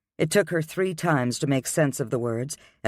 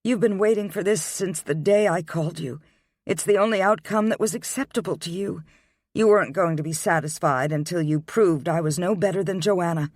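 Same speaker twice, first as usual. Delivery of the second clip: put-on higher, bewildered young woman protesting; 6 semitones higher than usual. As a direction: push back, hurt and confused, pitched high.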